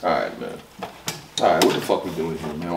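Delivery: low, deflated mumble; defeated. Real, not acted. Reviewer overwhelmed by the bars, defeated. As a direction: drop low and give up. Gives up after the stunning bars.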